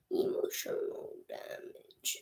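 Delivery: slow hushed whisper; slow mock-dramatic whisper; whispered meme. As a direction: breathe it out as a joke. Meme quote aside.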